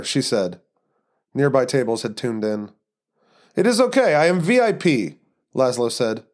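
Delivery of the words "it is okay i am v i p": put-on Eastern European accent. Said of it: "terrible Eastern European accent".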